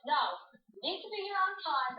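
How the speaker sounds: high mock yell of an angry coach; retold yell. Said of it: "he's, like, yelling at me".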